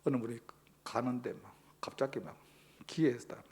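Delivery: normal talking voice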